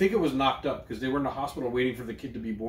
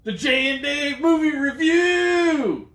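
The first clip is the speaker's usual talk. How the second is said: big booming announcer sign-off, stretched out; show announcer; 13 semitones higher, 8 dB louder, slower than usual. Pretend. Host booming the podcast sign-off to listeners, hammed-up announcer.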